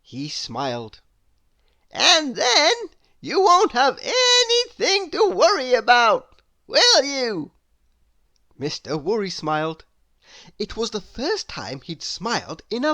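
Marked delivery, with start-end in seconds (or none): 1.9-7.5 loud, high kindly advice; booming friendly wizard; helpful wizard. Acted. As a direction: offer the plan grandly. Wizard voiced in story.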